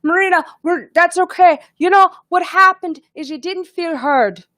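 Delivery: high-pitched imitation of Lois's Irish accent, comforting. Imitating Irish friend Lois consoling her after the doctor, high Irish accent. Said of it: "I know I'm doing her accent really bad".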